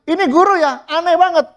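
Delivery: put-on high complaining child voice; son complaining. Preacher imitates his son griping about the teacher, high and whiny. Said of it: "dia bilang".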